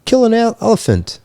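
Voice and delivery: high, fast incredulous squeal; mock disbelief, voice shooting up. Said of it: "I was like".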